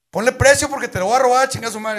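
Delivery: shouted, bullying demand; loud threat. Acted. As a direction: yell it like a thug. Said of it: "decía el viejo". (amenaza a gritos)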